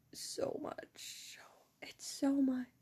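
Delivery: very soft, whispered emphasis; overwhelmed whisper. Real, not acted. Overwhelmed remark about the teacher.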